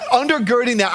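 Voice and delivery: normal talking voice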